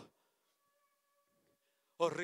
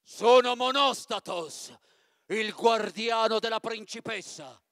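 much louder, booming guard's voice; giant guard captain; 3 semitones higher, 11 dB louder than usual. Acted. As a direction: announce yourself like a hulking giant. Capo delle guardie, gigante. (gigante che tuona forte)

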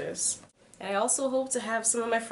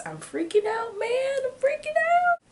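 very high-pitched panicked squeal; mock freak-out; 4 dB louder than usual. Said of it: "I'm scared. I am extremely nervous".

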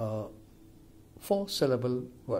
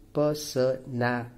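"personality" broken up as per-so-na-li-ty; syllable by syllable. Shown as a demonstration. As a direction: count out "personality" in separate, even syllables. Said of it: "this is a five-syllable word".

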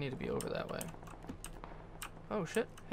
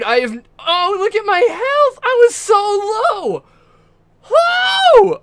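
louder, higher victory yelling; excited shouting; 5 semitones higher, 10 dB louder than usual. Real, not acted. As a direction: scream with the joy of winning. Winning the match, shouting in joy.

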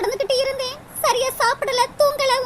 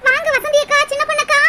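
normal talking voice

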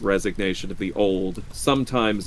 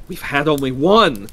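raised, insistent voice; eager reply; 6 semitones higher, 6 dB louder than usual. A narrator's reading. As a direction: insist excitedly, higher and louder. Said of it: "she replied rapidly".

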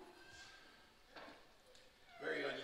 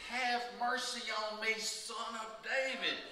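raised and slowed, a retold plea called out; mock shout; 7 semitones higher, 6 dB louder than usual. A mother calling out to Jesus for her child, mock shout. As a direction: cry out to Jesus for mercy, in a restrained shout. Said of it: "she shouted".

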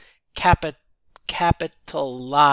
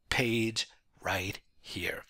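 whisper; 5 semitones lower, 9 dB quieter, much slower than usual. Real, not acted. Trailing aside.

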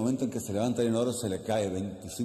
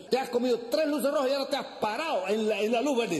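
high, sustained baffled protest; exasperated passenger; 13 semitones higher than usual. Acted. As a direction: pitch it way up in disbelief.

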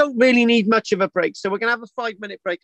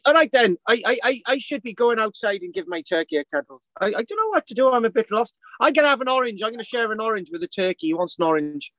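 put-on Irish accent; 3 semitones higher than usual. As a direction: lilt along in a silly Irish brogue. Keeps up the comic Irish voice.

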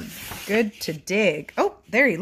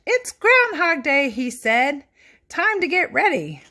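put-on lively read-aloud for children; animated picture book narration; storybook reading; 3 semitones higher, 5 dB louder than usual. A narrator's reading. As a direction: read it like bedtime story time.

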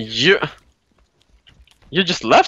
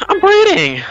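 loud, very high squeal; squeaky retort; 5 dB louder than usual. Real, not acted. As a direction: squeal it back at your partner. Retort to asking for a breather.

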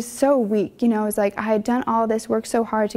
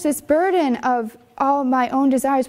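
ordinary talk

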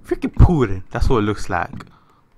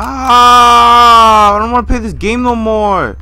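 high, loud, slow pleading; frightened drawn-out whine; whining in fear; 8 semitones higher, 7 dB louder, slower than usual. Real, not acted. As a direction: beg to stop playing. Terrified after jump scare.

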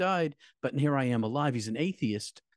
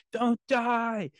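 retold frantic plea, voice pitched way up; imitated crying yell; 12 semitones higher, much slower than usual. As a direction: act out a friend's desperate sobbing cries. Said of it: "he's crying, and he's screaming, and he's yelling".

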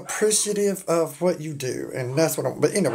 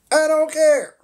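high, loud, defiant declaration; 10 semitones higher, 7 dB louder than usual. Real, not acted. Streamer shrugging off people who will get upset, defiant. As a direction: belt it out, high and unbothered.

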